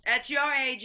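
loud, cutting interruption; sharp rebuke. Acted. Mother cutting off a complaining teenager, loud and firm. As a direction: cut in loudly and firmly.

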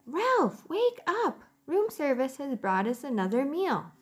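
high mother mouse voice; a bright mouse mother calling. Acted. Mother mouse waking Ralph.

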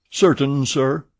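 a lightly voiced engineer, confident; engineer's reply. A narrator's reading. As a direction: answer like a sure ship's engineer. Engineer answers Fogg.

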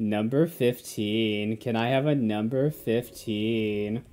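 low, slow, soft countdown voice; Chills. Acted. Joining in on the joke, low slow Chills impression. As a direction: drag it out low like a narrator impression. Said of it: "doing, like, a Chills impression".

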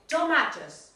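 Tomatoes with the ma stressed; teacher correction; model word. Shown as a demonstration. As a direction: say Tomatoes for the class to repeat.

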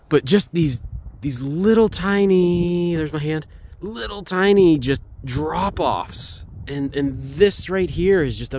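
high, drawn-out marvelling; excited. Real, not acted. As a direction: stretch the small details out in delight. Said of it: "I am excited".

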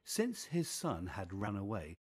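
usual speaking voice

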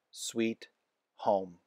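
plain reading voice

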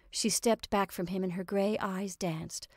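plain reading voice

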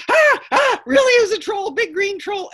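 very high, loud shriek of alarm; panicked goat. Acted. Medium goat fleeing the ranger, shrieking in panic.

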